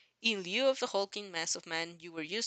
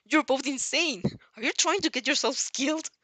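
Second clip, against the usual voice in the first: loud, higher, scolding shout; exasperated yell; 6 semitones higher, 7 dB louder than usual. A narrator's reading. All Might shouting at the two reckless kids mid-flight. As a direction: yell at them for being insane.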